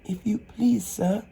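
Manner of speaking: put-on small, high, timid girl's voice. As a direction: ask shyly, barely daring. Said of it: "in a low, timid voice".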